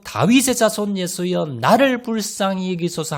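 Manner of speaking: high, loud desperate cry for mercy. Acted. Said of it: "더 큰 소리로".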